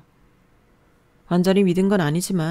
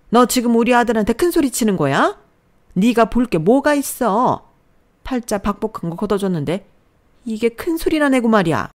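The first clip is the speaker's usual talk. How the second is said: put-on higher-pitched, indignant older relative; scolding mother-in-law; 7 semitones higher, 7 dB louder than usual. A narrator's reading. Mother-in-law defends her son, indignant scolding.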